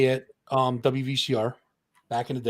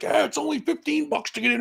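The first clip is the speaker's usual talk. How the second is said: much higher showman monster voice plugging the show; 12 semitones higher, faster than usual. Retold or imitated. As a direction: ham it up like the stage character. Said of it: "odorous mode".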